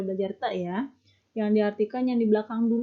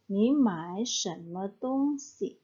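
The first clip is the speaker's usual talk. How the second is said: slow model sentence; much slower than usual. Shown as a demonstration. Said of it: "Jadi kamu beli barang apa ya?".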